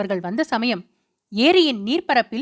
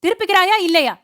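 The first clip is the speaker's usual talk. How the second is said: higher, angry shout; husband shouting at the boatman to turn back; 6 semitones higher, 4 dB louder than usual. A narrator's reading. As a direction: yell the order across the wind. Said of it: "என்று கத்தினான்".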